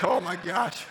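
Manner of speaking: loud, high mock-tearful exclamation; mock crying. Speaker retells his tearful reaction to her story, mock crying. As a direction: blurt it out, pretending to be overcome. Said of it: "and I'm crying".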